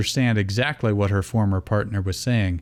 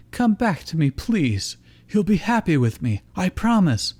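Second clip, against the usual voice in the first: pleading Dawn; 8 semitones higher than usual. A narrator's reading.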